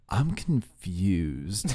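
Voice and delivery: very slow, soft, bewildered; puzzled. Retold or imitated. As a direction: let the confusion stretch out. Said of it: "puzzled look on his face".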